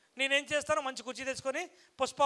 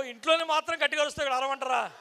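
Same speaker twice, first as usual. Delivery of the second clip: usual speaking voice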